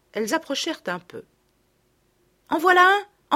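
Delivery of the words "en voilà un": higher, louder eager cry; a girl spotting the hedgehog, excited. Acted. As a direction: point it out eagerly.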